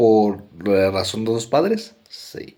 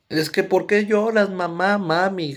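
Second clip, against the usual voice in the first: mock child asking mom why she cries; pleading child voice; 8 semitones higher than usual. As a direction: beg like a small kid asking mommy. Child asks crying mom, retold.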